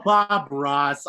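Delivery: ordinary talk, nothing marked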